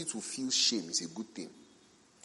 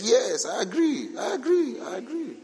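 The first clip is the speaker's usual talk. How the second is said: high, mock-eager girl voice, half laughing; girl's eager agreement; 13 semitones higher, 6 dB louder than usual. Preacher mimics the heartbroken friend agreeing, high and eager. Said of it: "And the girl is like".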